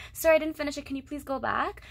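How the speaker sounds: politely asking sir to go back, can you please go back; a polite student's please go back; polite request. Shown as a demonstration. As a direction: ask it nicely, with the please. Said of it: "you could say that".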